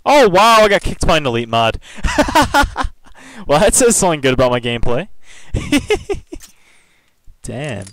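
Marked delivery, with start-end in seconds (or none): 0.0-1.8 loud, high burst of disbelief; incredulous. Real, not acted. Gets kicked from the game. 1.8-2.9 high-pitched burst of laughter; incredulous laugh. Real, not acted. Laughs at being kicked. 5.1-6.4 very high-pitched giggling; amused giggle. Real, not acted. Laughs at being kicked.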